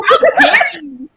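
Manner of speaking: high, loud indignant cry; mock outrage. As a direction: explode in comic indignation. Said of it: "And I'm like".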